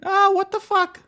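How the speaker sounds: very high put-on kid voice; cursing child. Scolded pretend child protests, squeaky child voice. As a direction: curse like a squeaky little child. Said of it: "you sound like a child".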